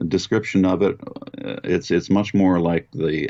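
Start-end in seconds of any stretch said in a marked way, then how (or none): none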